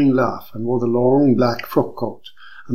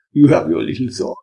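said with a smile; 4 dB louder than usual. Acted. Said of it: "Hanno said with a smile".

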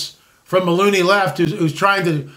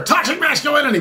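high mocking cry of toxic masculinity; mock scream; 8 semitones higher, faster than usual. Mocking the people screaming over here, a mock shriek. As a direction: parody the screaming crowd, pitched up. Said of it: "these people screaming over here".

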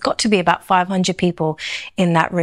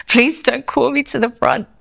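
higher-pitched, lightly panicked inner plea; mock pleading dread; 5 semitones higher than usual. Woman at the back dreads pastor's call, pleading. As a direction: beg silently not to be singled out, half in jest. Acting her dread at being called up.

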